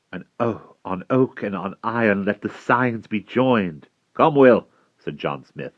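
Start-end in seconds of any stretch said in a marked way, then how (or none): 0.1-3.9 slightly raised, higher character voice; lady's proclamation. A narrator's reading. Lady proclaims the joining of signs. 4.1-4.7 loud, high summons; calling out. A narrator's reading. Smith calls Will to the anvil.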